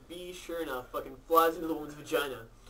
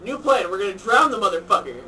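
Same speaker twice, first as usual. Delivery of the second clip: loud, emphatic declaration; loud punchline; 5 semitones higher, 12 dB louder than usual. Retold or imitated. Doctor announcing his new plan, loud and emphatic.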